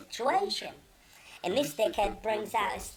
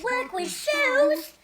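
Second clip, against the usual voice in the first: much higher and louder, stretched slow on the first words; hammed-up bewildered friend; mock confused Rob; 13 semitones higher, 7 dB louder, slower than usual. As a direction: play Rob baffled and squeaky. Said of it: "And he goes".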